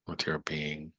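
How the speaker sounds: usual speaking voice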